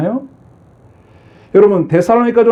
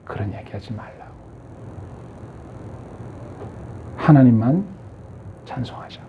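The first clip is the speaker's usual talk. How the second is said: near-whispered, softer rebuke; Job's hushed reply; 8 semitones lower, 11 dB quieter than usual. Retold or imitated. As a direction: hush her gently with faith. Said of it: "욥은 얘기합니다".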